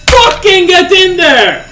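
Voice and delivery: roaring high and loud at the win; full-throated triumphant shout; real victory shout. Real, not acted. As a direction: yell it out as the win lands. Big gambling win erupts.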